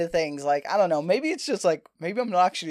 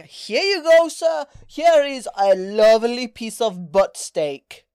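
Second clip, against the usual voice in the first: waiter voice; 4 semitones higher, 7 dB louder, much slower than usual. Retold or imitated. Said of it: "And they're like".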